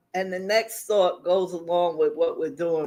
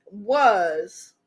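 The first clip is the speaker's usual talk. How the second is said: normal talking voice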